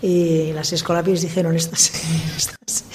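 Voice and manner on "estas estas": hushed whisper between giggles; slow, breathy gossiping whisper; retold whisper. Nuns whispering about the girls.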